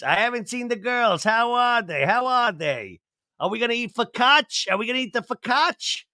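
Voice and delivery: high Jersey Italian nonna voice; Jersey grandma accent. Retold or imitated. Nona asking about the girls and focaccia, high Jersey accent. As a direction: fuss like a Jersey nonna over food. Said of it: "every one of my Jersey people are gonna be with that accent".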